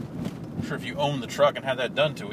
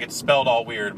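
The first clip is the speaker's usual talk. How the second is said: ordinary talk, nothing marked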